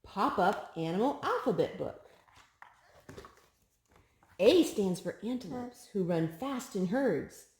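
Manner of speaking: lilting picture-book read-aloud; sing-song storybook reading; reading to a child. Acted. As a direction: read each rhyme brightly to a toddler.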